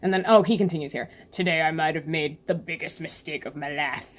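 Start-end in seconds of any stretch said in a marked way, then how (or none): 1.3-4.1 villain voice. Acted. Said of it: "giving him different voices every time".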